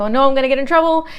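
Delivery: high, loud, rapid re-enacted panic; mock freak-out. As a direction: blurt it out in a flustered rush. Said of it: "I was freaking out".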